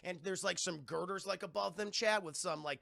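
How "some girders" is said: usual speaking voice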